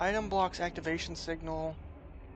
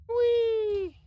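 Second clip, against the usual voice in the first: high gleeful squeal; gleeful whee; 11 dB louder than usual. Real, not acted. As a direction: squeal with glee. Gleeful cry at enemies.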